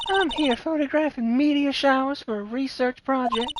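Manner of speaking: chirpy country gal perking up at a murder; Southern Lotta voice. Acted. Lotta hears about a murder, high eager Southern twang.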